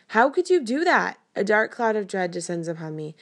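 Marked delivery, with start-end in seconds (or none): 0.1-1.2 high, hurt demand; upset outburst. A narrator's reading. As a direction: accuse her, stung and loud.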